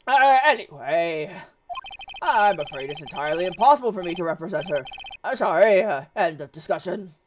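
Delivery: blustering, stammering lawyer role; evasive Grossberg. Acted. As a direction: fend them off with loud hesitations. Grossberg ends the discussion.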